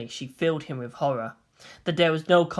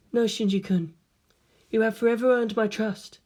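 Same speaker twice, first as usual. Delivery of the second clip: a hint of a higher, steady voice; calm Rei; 5 semitones higher than usual. Rei reassuring Shinji of her trust, calm and steady. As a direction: reassure him evenly.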